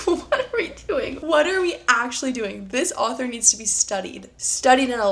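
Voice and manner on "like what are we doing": high, helpless giggle through the question; incredulous laughing. Real, not acted. Reader reacting to a cringey book line, laughing incredulously. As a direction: laugh it out, higher and softer.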